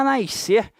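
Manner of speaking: NASCER as "naish-ser", with a hushing S; carioca pronunciation. Shown as a demonstration. Carioca teacher mimicking locals, "naish-ser" for NASCER. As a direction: put an "ish" before the C in "nascer". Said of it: "nós cariocas, a gente cisma em falar".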